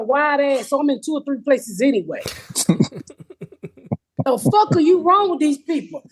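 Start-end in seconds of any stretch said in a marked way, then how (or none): none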